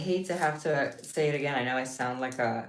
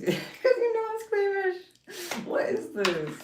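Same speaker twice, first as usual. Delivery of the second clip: laughing squeamish; slower than usual. Real, not acted.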